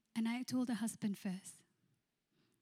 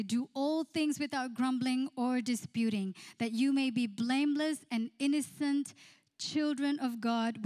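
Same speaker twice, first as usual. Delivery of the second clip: raised, declaiming Bible reading; scripture reading; 2 semitones higher, 3 dB louder than usual. Acted. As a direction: proclaim the verse to the congregation. Reads scripture aloud.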